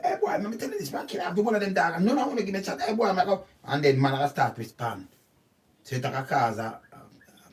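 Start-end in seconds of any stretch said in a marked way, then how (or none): 0.0-3.4 much higher, laughing, fast mock taunt; mock swaggering neighbour. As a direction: play the cocky neighbour starting a quarrel, half laughing. Acted swaggering neighbour quarrel.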